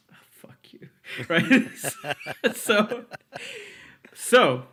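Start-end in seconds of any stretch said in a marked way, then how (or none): 0.1-0.9 very soft, voiceless mock curse; muttered retort. Intern to partner who showed up hours late, muttered retort. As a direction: curse under your breath, half laughing.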